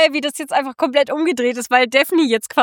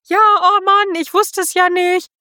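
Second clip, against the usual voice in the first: high, slow mock apology; flustered Daphne; 6 semitones higher, slower than usual. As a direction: squeak out a hammed-up sorry. Daphne voiced in retelling.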